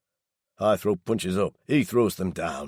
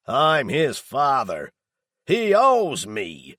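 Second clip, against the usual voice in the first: higher, louder, very slow bellow; ranting shout; 6 semitones higher, 6 dB louder, much slower than usual. A narrator's reading. Daryl rants about his son owing him, furious bellow. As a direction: hammer each word with rage.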